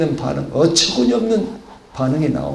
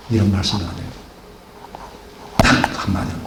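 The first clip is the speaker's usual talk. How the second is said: ordinary delivery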